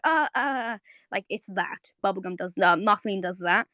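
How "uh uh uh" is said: higher, stuttered uhs; stuttering. Shown as a demonstration. Showing how someone starts backtracking, stuttering higher. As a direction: stammer as if caught out. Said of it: "you start, like, stuttering".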